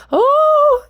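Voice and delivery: squeaky high, loud surprised oh; mock gasp. Imitating people reacting to the word ascension, high and loud.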